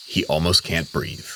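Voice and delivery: ordinary delivery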